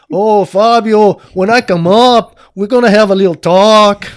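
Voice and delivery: big loud voice in a hammed-up Brazilian accent; Marcelo's Brazilian accent. Angry Brazilian coach calling up his student, booming Brazilian accent. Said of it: "A big guy, a big voice".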